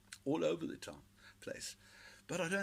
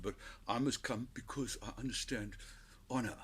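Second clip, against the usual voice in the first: ordinary delivery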